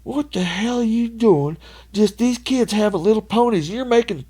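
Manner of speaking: high, slow, hammed-up angry tirade; imitating dad chewing out the cop; dad scolding the officer, mock rage; dad furious at cop. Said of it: "tore him a fucking new asshole".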